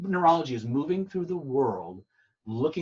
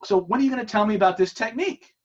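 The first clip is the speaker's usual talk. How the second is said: higher and louder, imitating her impatience; impatient girlfriend; 9 semitones higher, 6 dB louder than usual. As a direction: push the question up high like she's losing patience. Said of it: "she goes to him".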